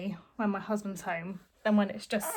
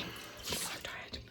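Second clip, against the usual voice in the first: whisper; 15 dB quieter, slower than usual. Real, not acted. Whispered aside about tiredness.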